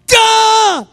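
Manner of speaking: high, sudden "duh!" yelp; one high burst of overwhelmed groaning; jolted cry. Retold or imitated. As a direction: act out being struck by a jolt. Acts out being jolted into pillow.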